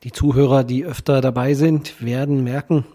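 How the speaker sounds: ordinary delivery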